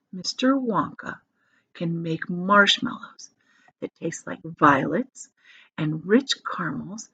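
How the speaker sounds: slow, soft, careful telling; speaking very slowly. Acted. Grandpa Joe slowing down so Charlie hears every word. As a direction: lay each word out slowly. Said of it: "speaking very slowly now".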